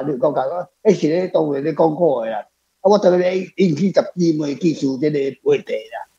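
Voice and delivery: ordinary talk, nothing marked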